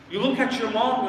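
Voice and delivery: ordinary talk, nothing marked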